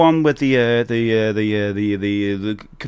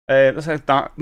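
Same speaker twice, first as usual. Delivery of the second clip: ordinary talk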